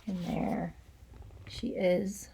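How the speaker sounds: quiet narrator aside; hushed aside. Acted. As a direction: drop to a near murmur for the listener.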